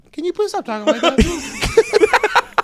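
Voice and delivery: put-on very high, slow little kid voice; tiny pleading voice, drawn out; sixth grader. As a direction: imitate an innocent kid with an impediment. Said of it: "a sixth grader".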